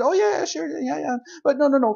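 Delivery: exasperated sarcastic agreement. Real, not acted. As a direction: roll your eyes through it. Sarcastic rant about being rushed.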